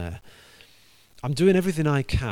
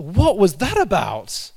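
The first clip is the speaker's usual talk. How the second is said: high, loud, slow bewildered question; startled waking; 8 semitones higher, 9 dB louder, slower than usual. Retold or imitated. Man wakes from dream and asks, high and loud. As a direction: jolt awake baffled.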